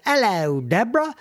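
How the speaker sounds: put-on playful British-accented greeting, lilting up on the name; hammed-up English hello; mock British accent. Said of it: "from the other side of the pond".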